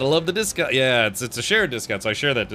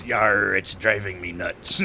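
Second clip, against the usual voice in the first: pirate voice; 3 semitones lower, slower than usual. Acted. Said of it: "And the pirate says".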